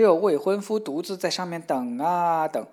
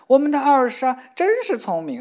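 higher, louder, gushing praise; a mother's overjoyed praise; proud mother; 9 semitones higher, 9 dB louder than usual. Acted. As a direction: gush with pride over the daughter.

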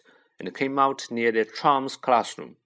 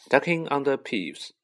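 ordinary delivery

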